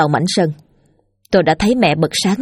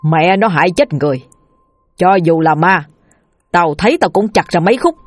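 gruffly defiant father, mildly raised; angry threat. Acted. Angry father vows to chop up the ghost, defiant. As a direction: vow revenge with contained anger. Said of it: "Bố tôi tức giận".

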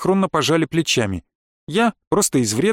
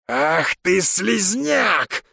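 very slow, high, drawn-out shout; outraged cry; 4 semitones higher, much slower than usual. A narrator's reading. Грабонги rising to attack the narrator, crying out in fury. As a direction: stretch the insult into a cry. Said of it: "вскрикнул Грабонги".

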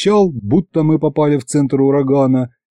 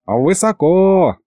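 put-on slow, high-pitched old man; dragged-out objection, raised pitch; drawn out; 6 semitones higher, slower than usual. A narrator's reading. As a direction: stretch the vowels out. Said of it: "протянул дед".

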